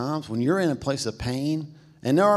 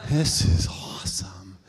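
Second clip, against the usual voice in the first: slow, hushed whisper of wonder; awed whisper; 6 semitones lower, slower than usual. Retold or imitated. Kids stepping off the subway into Times Square, awed whisper. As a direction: breathe it out like a dazzled kid.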